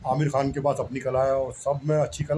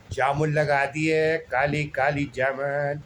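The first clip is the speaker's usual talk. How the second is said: slow, drawn-out hawker's cry; street vendor call; much slower than usual. Retold or imitated. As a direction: imitate a fruit seller calling out his jamun. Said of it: "ऐसे आवाज़ें निकालते हैं ना".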